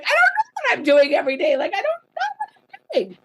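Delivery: high-pitched, rising into a squeaky panic; mock-panicked wail of not knowing; overwhelmed whine. Answering her husband in a high panicked whine.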